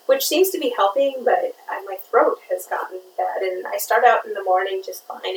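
ordinary talk, nothing marked

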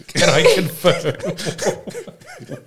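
barely getting it out through laughter; slow question broken up by giggles; laughing. Real, not acted. Listener checking the messy detail with the storyteller, laughing.

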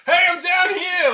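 high, loud call down a corridor. Retold or imitated. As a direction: shout it down the hall. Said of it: "calls out".

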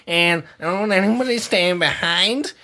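hammed-up party-pooper, half laughing; mock grumpy refusal. Host playing his own refusal to friends, mock grumpy. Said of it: "that's what I talk like when".

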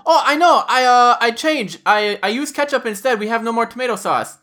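high, excited wife voice; high-pitched mock confession; imitated wife. Wife confesses in retold story.

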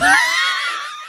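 high shriek of laughter. Real, not acted. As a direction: burst into a squealing laugh.

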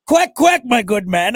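high, loud British duck; British accent. Acted. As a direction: quack like a proper British gentleman. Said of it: "British go".

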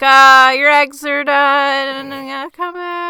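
loud, high, slow call from the porch; calling after her. Retold or imitated. Charlie calls after the fleeing daughter, loud and high. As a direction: shout it after someone leaving.

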